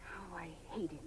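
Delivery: hateful words under the breath; seething whisper. Acted. Actress venting about her director, seething whisper. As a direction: whisper it, full of resentment.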